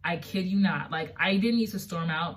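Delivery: normal talking voice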